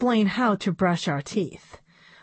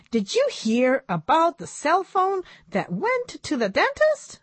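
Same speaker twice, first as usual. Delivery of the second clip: high puppet voice setting up a joke; high-voiced puppet comedian; monkey puppet; 10 semitones higher than usual. A narrator's reading. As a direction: pitch it up like a joking monkey puppet.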